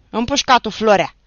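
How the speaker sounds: furious shout. Acted. The commander calls the roll, shouting furiously. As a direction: yell the name even angrier. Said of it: "strigă comandantul și mai furios".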